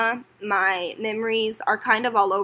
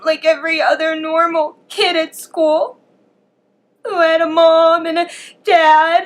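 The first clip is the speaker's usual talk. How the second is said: holding back tears, then breaking higher and louder; real crying; 8 semitones higher, 4 dB louder than usual. Real, not acted. Telling her audience how much her father's absence hurt, crying for real. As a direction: voice rising high and breaking into tears.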